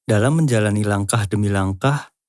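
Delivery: ordinary talk, nothing marked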